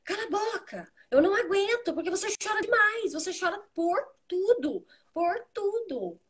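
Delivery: high-pitched, exasperated mock scolding; imitação de mãe irritada. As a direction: act out a mother at the end of her patience, voice way up. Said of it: "eu falava assim, sem, desse jeito". (bronca exasperada imitada, em voz aguda)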